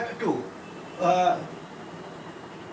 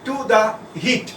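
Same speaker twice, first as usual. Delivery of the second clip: slow dictation; much slower than usual. Acted. Dictating sentences to a class.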